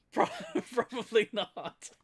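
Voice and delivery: giggling denial; laughing. Real, not acted. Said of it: "laughing at own claim".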